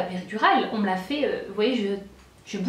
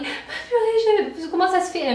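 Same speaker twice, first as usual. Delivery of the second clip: high, drawn-out pained exclamation; dismayed cry; 8 semitones higher, 4 dB louder, slower than usual. Retold or imitated. Woman telling her husband she still feels the pain, high dismayed cry. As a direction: let the dismay stretch out high and slow. Still feeling contractions after epidural.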